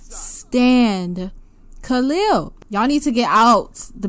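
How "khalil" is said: ordinary talk, nothing marked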